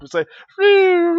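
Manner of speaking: long, high, loud siren-like whine; imitated car toy noise. Kid imitating the toy car's noise, a long loud whine. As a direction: mimic the toy's shrill car noise. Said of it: "those car noises".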